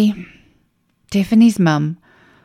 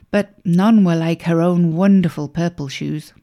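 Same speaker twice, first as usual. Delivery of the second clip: ordinary delivery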